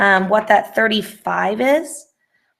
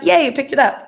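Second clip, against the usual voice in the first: small higher-pitched cheer of relief; pleased cheer; 6 semitones higher than usual. Real, not acted. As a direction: brighten up, pleased that it worked. Relief when the typed accent works.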